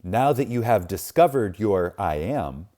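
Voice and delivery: usual speaking voice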